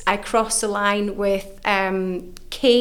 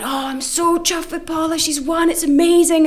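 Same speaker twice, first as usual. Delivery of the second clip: much higher, thrilled chatter; excited gushing; 8 semitones higher, 4 dB louder than usual. Retold or imitated. Telling the spa woman about Paula's win, excited. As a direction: gush like a fan.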